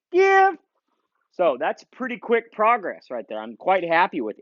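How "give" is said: release command. Real, not acted. Commanding dog to release bumper.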